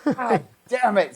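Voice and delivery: high, drawn-out mock frustration; retold exasperation. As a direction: groan it slowly as a thwarted teen. Retold frustration at recruiter's refusal.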